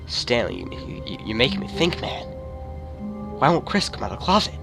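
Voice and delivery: ordinary delivery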